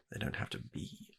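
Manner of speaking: hushed aside, breath only; whisper. Acted. Receptionist whispering an aside about the client's answer. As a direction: whisper it to yourself in disbelief.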